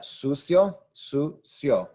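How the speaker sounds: "sucio" as SOO-syo, stress on SOO; blended vowels. Shown as a demonstration. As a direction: run the I and O of "sucio" into one "syo". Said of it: "gonna make one sound".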